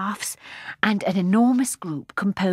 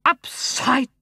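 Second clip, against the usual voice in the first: slow, high, indignant snort; the fat old gentleman snorts at Jane; 7 semitones higher, 5 dB louder, much slower than usual. A narrator's reading. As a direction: huff it out, deeply offended. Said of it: "he snorted".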